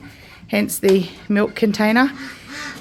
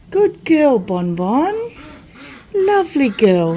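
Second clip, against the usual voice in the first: cooing pet talk; 5 semitones higher, slower than usual. Real, not acted. Coaxing cow Bonnie.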